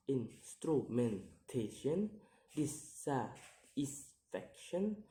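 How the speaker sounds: slow word list, each word broken into syllables; careful, separated syllables for learners. Shown as a demonstration. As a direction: sound out each word piece by piece. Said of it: "count the word syllable backwards".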